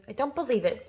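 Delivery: plain reading voice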